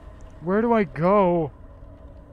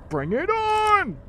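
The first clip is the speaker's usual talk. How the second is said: high, slow drawn-out dare; defiant shout; slower than usual. Real, not acted. Shouting challenge again.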